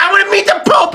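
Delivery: high, shouted demand; whiny demand. Acted. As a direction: demand it like a spoiled child. Mocking Vance demanding.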